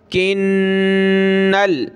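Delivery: ordinary talk, nothing marked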